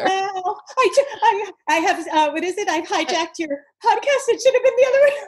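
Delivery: high, loud, rushed apology; excited. Real, not acted. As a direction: gush out apologetically. Host excited, laughing apology.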